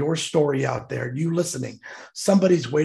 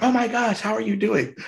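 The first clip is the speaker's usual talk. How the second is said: high-pitched, re-enacted happy surprise; excited greeting; 8 semitones higher than usual. Greeting the woman who found him, excited. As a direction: greet an old friend in a high, delighted voice. Acted delighted greeting of old acquaintance.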